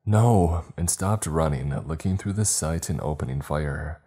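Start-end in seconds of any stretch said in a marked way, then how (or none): 0.0-0.6 louder, higher cry of no; raised-voice scream in narration; narrated scream. A narrator's reading. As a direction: cry out in alarm, short of a full scream. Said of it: "I screamed".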